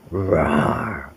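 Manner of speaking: low, soft growling roar; mock big cat roar; puma roar. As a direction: play the puma for a child. Imitating puma.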